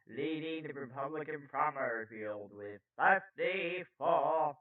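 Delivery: slow, emphatic news-anchor delivery; news read. Acted. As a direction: punch key words, lingering on figures.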